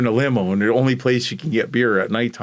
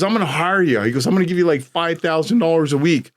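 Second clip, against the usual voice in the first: eager offer; 6 semitones higher than usual. Retold or imitated.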